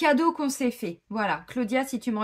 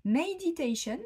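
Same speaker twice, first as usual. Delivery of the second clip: "Meditation" said the English way, higher; mock English accent; 5 semitones higher than usual. Shown as a demonstration. Switching to English for fun, mock English accent. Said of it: "Vous avez vu mon accent?".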